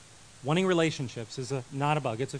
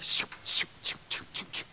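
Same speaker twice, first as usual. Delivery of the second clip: whispered shoo shoo sounds; breathy shushing like kids rushing in; shushing noises. Retold or imitated. Kids rush the door, whispered shoo sounds.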